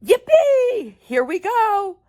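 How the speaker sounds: high, loud cheer; thrilled whoop. Acted. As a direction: ride the rush with glee. Grandpa Joe on rocketing lift.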